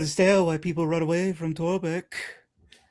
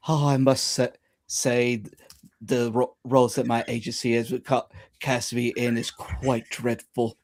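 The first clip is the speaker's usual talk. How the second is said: put-on posh accent; 6 semitones lower, 4 dB quieter than usual. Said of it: "speaks in, like, a posh tone".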